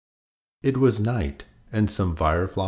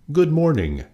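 bright, high cheerful greeting; open and friendly; 10 semitones higher, 4 dB louder than usual. A narrator's reading. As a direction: sing out a friendly good morning.

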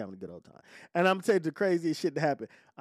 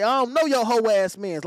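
retold tough comeback; 6 semitones higher, 6 dB louder than usual. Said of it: "like that".

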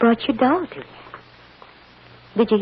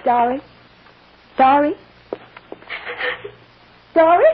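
rising calls, each louder; calling a lost child in the dark, louder each time; 5 semitones higher, 4 dB louder than usual. Acted. Mother calling for her daughter in a dark room, rising calls.

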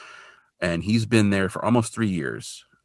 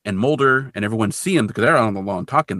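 normal talking voice

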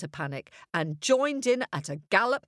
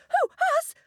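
much higher tiny-creature voice; squeaky innocent; 4 dB louder than usual. A narrator's reading. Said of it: "They all answered".

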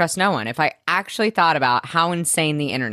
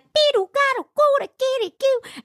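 silly mock voice. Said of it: "It's like".

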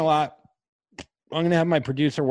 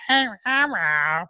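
slow, higher croaky voice; hoarse after screaming; 6 semitones higher, slower than usual. Retold or imitated. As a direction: rasp it like a blown-out voice. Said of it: "the next day being like".

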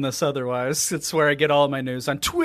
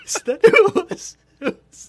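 laughing; 13 semitones higher, 4 dB louder, slower than usual. Real, not acted. Laughing at own joke.

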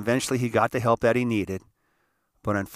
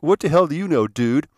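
raised, loud defiant retort; imitating attitude; 4 semitones higher, 6 dB louder than usual. Paul talking back during intervention, imitated loud attitude. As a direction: throw it back with mock attitude, dropping on the last word. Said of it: "he kinda gave me the attitude like".

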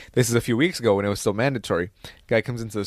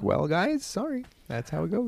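mock shrug; 7 semitones higher, 6 dB quieter than usual. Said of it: "And he's like".

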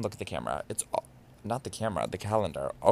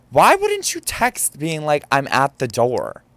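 bitchy; 5 semitones higher, 6 dB louder than usual. Retold or imitated. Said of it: "I said it like a bitch".

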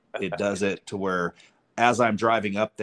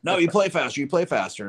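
higher, louder imitated squabble; mock argument; 9 semitones higher, 4 dB louder than usual. Golfers argue back at the buddy, mock heated retort. Retold argument on golf course.

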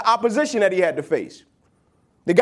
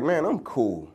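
low, softer and offhand; cool and unbothered; 10 semitones lower, 7 dB quieter, slower than usual. Retold or imitated. Achilles brushing off one more battle, low and unbothered. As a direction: shrug it off quietly.

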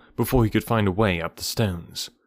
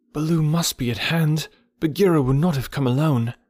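put-on boy's thought; 6 semitones higher than usual. A narrator's reading. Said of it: "Mowgli thought".

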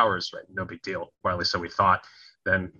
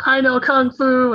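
high, loud taunting schoolboy voice; mocking bully kid; 8 dB louder, slower than usual. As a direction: jeer it like a playground bully. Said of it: "making mocking".

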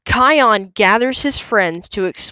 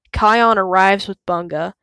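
usual speaking voice

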